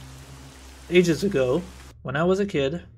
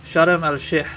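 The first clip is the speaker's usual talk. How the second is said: Sharm el-Sheikh with a rolled Arabic r, louder; Arabic accent; 6 dB louder, slower than usual. Shown as a demonstration. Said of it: "I'll say it in, like, in a kind of, uh, Arabic accent".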